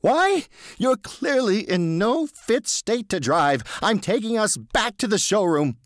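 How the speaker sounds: high, slow stern instruction; a hint of an angry salesman; firm stern Marvin. A narrator's reading. Marvin taking charge.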